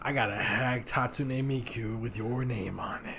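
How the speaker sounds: low hushed dealer whisper; shady dealer. Pretend. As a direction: sell it like a back-alley dealer.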